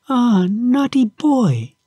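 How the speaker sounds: high, drawn-out playful scolding; arch, laughing tone. A narrator's reading. Little voice in the box teases Epimetheus, archly. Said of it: "in an arch and laughing tone".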